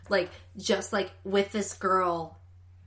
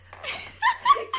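squealing giggle, very high; high burst of laughter; 4 dB louder than usual. Real, not acted. Trying to tell the story but breaking into high laughter. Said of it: "trying to say this with a straight face".

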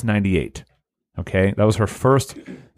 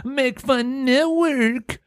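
much higher, booming show-intro announcer, stretching the show name slowly; much slower than usual. Acted. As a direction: hype up the listeners like a cartoon show announcer. Sponsor tag in announcer voice.

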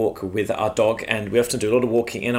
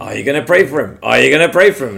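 louder, pressing the question home; insistent repeated prompt; 2 semitones higher, 7 dB louder, faster than usual. Retold or imitated. Spirit urging the storyteller to pray, insistently louder. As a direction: push harder with each repeat. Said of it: "I can hear the Spirit saying to me".

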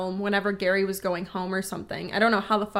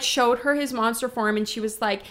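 ordinary talk

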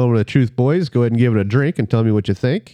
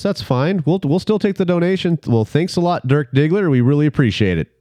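ordinary talk

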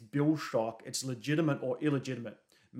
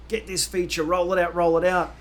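raised, louder urgent demand; pushy mock shouting, pitched up; mock screaming; 4 semitones higher, 5 dB louder than usual. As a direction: imitate a boss yelling at engineers. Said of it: "people screaming at your engineers saying".

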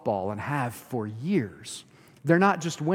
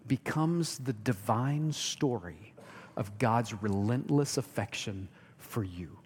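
ordinary talk, nothing marked

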